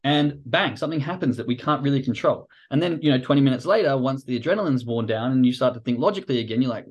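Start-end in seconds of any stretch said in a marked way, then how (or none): none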